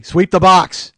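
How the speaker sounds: loud, forceful order; sensei shout. Acted. Sensei from Karate Kid insisting to his student, shouted. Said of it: "the sensei from Karate Kid".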